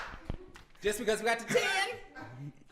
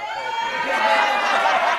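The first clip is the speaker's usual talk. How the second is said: laughing; 14 dB louder, much slower than usual. Real, not acted. Laughing joke about sixty-nine.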